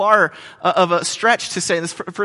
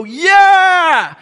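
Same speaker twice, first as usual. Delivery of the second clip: much higher, loud mock cheer; crowd cheer; 12 semitones higher, 9 dB louder than usual. Pilgrims cheering back at the opener. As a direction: yell like a thrilled crowd. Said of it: "the people would go".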